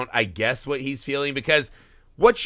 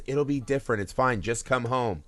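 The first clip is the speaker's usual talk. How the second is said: soft, imitated reassurance; gentle coaxing; 6 dB quieter than usual. Husband luring wife back home, soft and coaxing. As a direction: coax quietly, as if smoothing things over.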